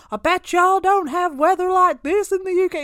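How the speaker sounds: loud, high-pitched American heckle; mock yell at passing strangers; imitated shouting. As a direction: shout it out like a drunk American man. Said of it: "He was shouting at us".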